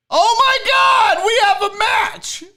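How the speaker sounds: high, loud, excited yell of discovery; a loud burst of real excitement; excited shout. Real, not acted. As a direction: shout it out like you just hit the jackpot. Excited find of a lookalike photo.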